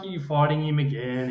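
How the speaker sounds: ordinary talk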